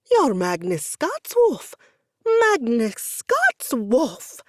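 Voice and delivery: stunned fan, close to passing out; near-faint fan squeal. Acted. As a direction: drag out the name in awe. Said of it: "only a few registers below that of a prepubescent girl".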